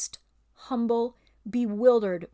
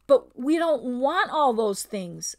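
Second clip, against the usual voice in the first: slow, louder objection; lightly voiced old bear; 3 semitones higher, 6 dB louder, slower than usual. Old bear objects to the ape's plans, slow. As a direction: object slowly and heavily.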